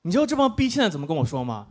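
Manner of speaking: ordinary delivery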